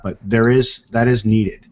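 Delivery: ordinary delivery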